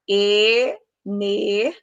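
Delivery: Energia drawn out slowly, I and A split apart; slow teaching pronunciation of Energia; syllable demo. Shown as a demonstration. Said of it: "na pronúncia de energia".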